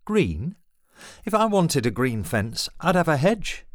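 put-on indignant old father blustering; outraged Green?. A narrator's reading. Dad outraged about fence colour.

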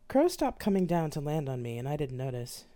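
normal talking voice

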